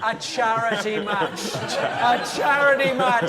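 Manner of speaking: high, slow disbelieving shout; incredulous laughing outrage. Real, not acted. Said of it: "incredulous retelling".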